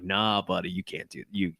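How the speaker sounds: low, soft, chuckling through it; gently brushing him off while laughing. Retold or imitated. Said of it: "Clark being like".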